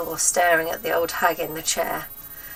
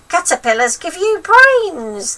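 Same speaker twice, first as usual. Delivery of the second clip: high, loud and slow crone voice; old hag lecturing a boy, high and slow; grandma insisting; 11 semitones higher, 11 dB louder than usual. A narrator's reading.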